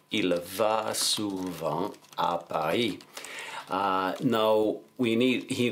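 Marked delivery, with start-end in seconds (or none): 0.1-3.0 very slow, drawn-out French, word by word; slow dictation. Shown as a demonstration. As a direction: dictate it slowly as if writing along. Dictating the sentence while writing it.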